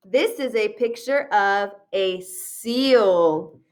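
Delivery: ordinary talk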